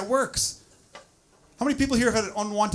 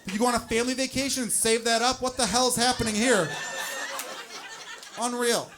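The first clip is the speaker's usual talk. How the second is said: high, louder exasperated complaining; outraged partner; 6 semitones higher, 4 dB louder than usual. Acted. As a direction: berate them in mock outrage. Acted outraged partner berating him.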